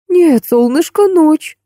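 high, drawn-out fussing coo; clucking nanny. A narrator's reading. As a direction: cluck it slowly over a little child. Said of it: "закудахтала Краузе". (кудахча, высоким голосом)

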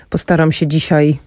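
ordinary talk, nothing marked

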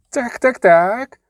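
high-pitched mocking boy; gloating youngster. A narrator's reading. Youngest brother holding horses finds the captive, gloating youngster.

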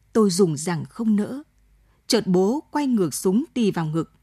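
ordinary delivery

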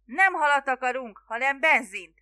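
high, loud correction; exasperated yell. A narrator's reading. Spiro telling the fisherman what they need, yelling. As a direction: yell it, at the end of your patience. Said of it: "üvöltötte Spiro".

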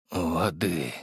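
low, weak croak. A narrator's reading. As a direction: croak like a crow with a parched throat. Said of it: "как карканье вороны". (хриплое тихое карканье)

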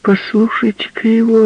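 high, loud, teasing call; mocking woman. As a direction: tease him to the crowd. Woman mocking to her friends.

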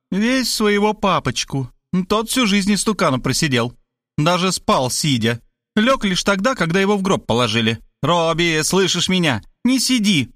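high-voiced scolding mother; high, disparaging motherly rant; insulting nag. A narrator's reading. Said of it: "уснастив его оскорбительными деталями".

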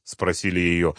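ordinary delivery, nothing marked

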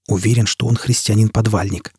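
ordinary delivery

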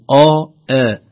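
first "آ" dark, second "آ" thin; heavy then light hamza. Shown as a demonstration. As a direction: contrast "آ" backed, then "آ" fronted. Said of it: "لا نقول". («آ» مفخمة ثم مرققة)

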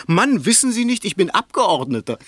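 half-laughing send-up of a pompous man; mock pompous boast. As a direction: lampoon a self-important deputy showing off to a policeman. Mocking the would-be deputy's boast.

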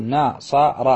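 ناصر split into slow na-sa-ra; teacher spelling out ناصر by its three syllables. Shown as a demonstration. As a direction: sound out ناصر, each syllable held and separate. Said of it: "تقول".

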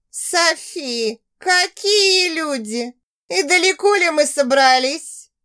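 nasty hissing voice, high and slow; snake voice. A narrator's reading. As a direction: hiss it out like a spiteful snake. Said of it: "Мерзким шипящим голосом проговорила Жанна".

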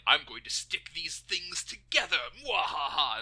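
loud villain voice with evil laugh; sneering panto villain. Retold or imitated. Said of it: "he's just like".

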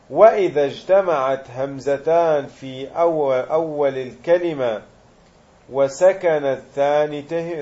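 slow, measured reading of the grammar text; reciting the text. Acted. Teacher reading the rule aloud to students, slow deliberate recitation. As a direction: read the rule out slowly for the class.

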